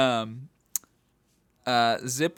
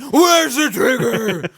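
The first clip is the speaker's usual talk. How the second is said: much higher, loud, slow gravel-voiced yell with a laugh; yelled Batman line; 11 semitones higher, 9 dB louder, slower than usual. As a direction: bark it out in a mock Batman roar. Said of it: "just yelling".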